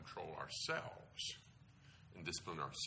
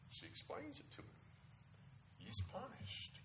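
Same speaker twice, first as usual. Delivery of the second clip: whispered aside; 12 dB quieter than usual. Real, not acted. Quiet aside narration.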